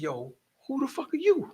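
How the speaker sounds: half-hammed high-pitched what-the-heck; amazed. Host amazed at stranger.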